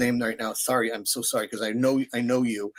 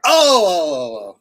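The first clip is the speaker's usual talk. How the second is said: high, loud drawn-out "ohh"; mock exclamation "oh"; 5 semitones higher, 9 dB louder than usual. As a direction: mimic a big falling cry of realization. Mimicking French partner's relieved reaction.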